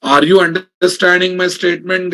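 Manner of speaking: ordinary talk, nothing marked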